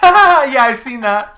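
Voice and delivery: high, loud laughing words. Real, not acted. Laughing at the Korone clip.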